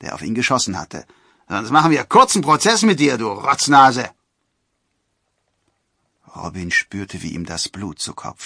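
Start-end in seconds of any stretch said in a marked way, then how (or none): 1.5-4.1 high, loud angry warning; forester's angry shout; shouted threat. A narrator's reading. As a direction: threaten and insult at full voice. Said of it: "rief der".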